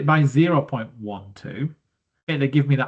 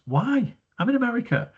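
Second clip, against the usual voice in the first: mock indignation; 9 semitones higher than usual. Real, not acted. Mock complaint about pounds.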